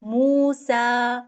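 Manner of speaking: stretched recited मूसा; Quran recitation. Shown as a demonstration. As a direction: draw out मूसा with two counts each. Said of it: "तो मैं उसको कैसे पढ़ूंगी?".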